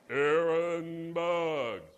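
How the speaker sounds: very slow, deep, loud divine call; booming voice of God. Retold or imitated. Pastor corrects himself, God calling his name, deep and booming. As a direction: draw the name out like thunder from heaven.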